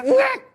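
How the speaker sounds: sharp high yelp of a shocked brain; startled jolt noise. Retold or imitated. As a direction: squeak like a jolt of electricity. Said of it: "Kayak kaget". (teriakan kaget melengking)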